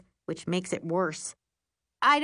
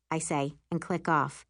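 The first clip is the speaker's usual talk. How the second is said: ordinary delivery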